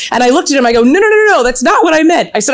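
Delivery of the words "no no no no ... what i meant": high, hurried retold denial; flustered protest. Wife hurrying to reassure [name], retold flustered protest. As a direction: rush to correct the misunderstanding, pitched well up. Hastily correcting [name]'s mistaken idea.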